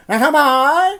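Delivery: high mock witch voice. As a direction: croon it like a storybook witch. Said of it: "she wasn't a witch".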